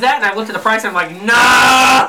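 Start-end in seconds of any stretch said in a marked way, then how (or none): 1.2-2.1 loud, higher, drawn-out "no"; retold loud refusal. Buyer recounting a reaction to the price, loud refusal. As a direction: belt out a mock-appalled no.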